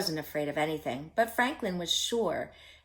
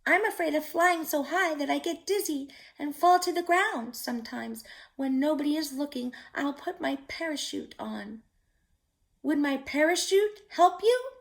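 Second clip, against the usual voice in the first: higher chirping little bird; a small bird confiding to a turtle; chirpy bird voice; 7 semitones higher than usual. A narrator's reading. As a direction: speak it like a nervous little bird. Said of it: "chirped the bird".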